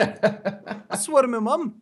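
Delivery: high, slow, badly faked Aussie; laughing hammed-up Australian; terrible Australian accent. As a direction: drag it out in a mock Australian voice, half laughing. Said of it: "for that terrible impression".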